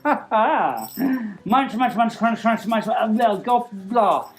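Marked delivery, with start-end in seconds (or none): 1.4-4.3 mock munching and crunching noises; eating sounds. Narrator imitating party guests munching on popcorn. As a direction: chomp through it like a cartoon. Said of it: "Those are eating sounds I'm doing".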